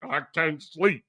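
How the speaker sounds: deep, dragged mocking whine; mocking Kylo whining. Mocking King Kylo again, low and sneering.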